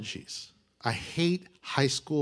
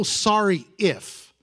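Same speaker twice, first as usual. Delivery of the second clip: dismissive "Sorry if"; 3 semitones higher, much slower than usual. Shown as a demonstration. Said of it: "you don't go".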